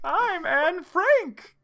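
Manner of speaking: put-on very high, slow girlish voice; Anne Frank. A narrator's reading. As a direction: draw it out high and loud, as a girl. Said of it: "said the girl".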